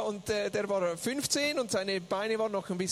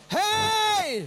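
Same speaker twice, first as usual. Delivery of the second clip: high, loud drawn-out shout; calling out; 11 semitones higher, 7 dB louder than usual. Retold or imitated. Interpreter as son to the crowd, loud shout. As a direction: holler across the crowd.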